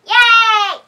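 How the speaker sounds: high, loud happy cheer; excited cheer. Real, not acted. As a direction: throw out a big yay for Halloween. Cheering the Halloween book.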